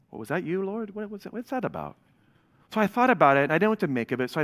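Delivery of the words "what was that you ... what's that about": higher, hushed questioning; softly puzzled, voice lifting; retold wonder. Speaker recalls asking the Lord what he felt, hushed wonder. Retells his startled question to God.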